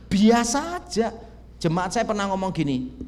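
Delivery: ordinary delivery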